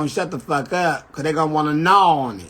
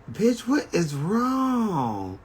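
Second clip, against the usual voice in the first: slow, high, drawn-out questioning; exaggerated concern; 4 semitones higher, much slower than usual. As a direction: stretch it out in mock alarm. Questioning crying friend, drawn out.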